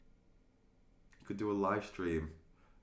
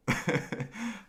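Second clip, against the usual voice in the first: high laugh; 13 semitones higher than usual. Real, not acted. Laughs at own mistake.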